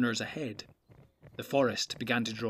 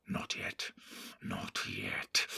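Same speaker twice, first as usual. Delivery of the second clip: hushed whisper, much softer; soft orc whisper; 10 dB quieter, much slower than usual. Acted. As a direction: whisper like a secret. Secret hushed.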